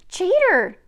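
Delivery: high, very loud squeal; startled indignant squeal; surprised squeal. Acted. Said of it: "Gigi squeals in surprise".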